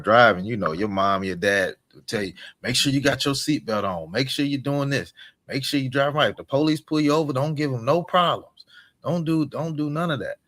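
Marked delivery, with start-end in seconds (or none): 2.6-10.4 parent's warning. Retold or imitated. Parents' warnings voiced in story.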